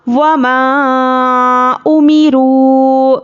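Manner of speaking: slow, melodic tajweed recitation; measured Quranic chant. Shown as a demonstration. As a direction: chant it slowly like a qari. Recites verse fragment.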